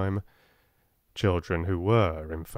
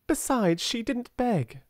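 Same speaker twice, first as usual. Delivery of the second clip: put-on high earnest girl; 13 semitones higher than usual. A narrator's reading. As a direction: press the point home. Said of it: "said Janet".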